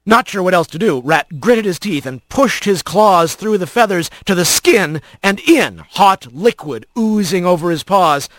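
plain reading voice

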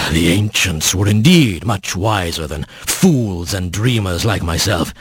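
low, heavy bear voice; a great bear sighing out his words; solemn bear. A narrator's reading. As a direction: pitch it well down, rueful and weighty. Bear's speech after a deep breath.

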